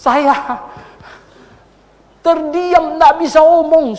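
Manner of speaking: high, loud, impassioned; emphatic preaching. Real, not acted. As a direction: hammer home the helplessness. (khotbah berapi-api)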